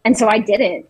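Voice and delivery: ordinary talk, nothing marked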